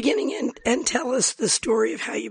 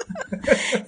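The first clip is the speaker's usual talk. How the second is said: brief amused laugh; short laugh. Real, not acted. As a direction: laugh it off lightly. Laugh after her joke.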